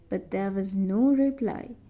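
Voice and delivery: plain reading voice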